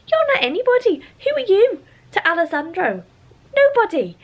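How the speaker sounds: high, loud sneering mock of the heroine; mocking possessive voice. Reviewer mocking the possessive heroine, high loud sneer. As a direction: jeer it like a jealous rival. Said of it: "this weird possession thing".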